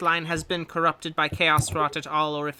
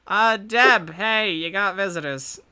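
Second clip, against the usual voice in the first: louder call across the room; 4 semitones higher, 6 dB louder than usual. Acted. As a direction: call out to get her attention. NPC calls out to Deb.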